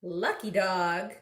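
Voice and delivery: ordinary delivery, nothing marked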